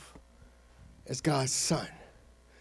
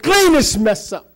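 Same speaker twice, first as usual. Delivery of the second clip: loud, slow command in retelling; upset Jesus; 7 semitones higher, 8 dB louder than usual. Retold or imitated. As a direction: order them out with raised voice and pitch. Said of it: "He was upset".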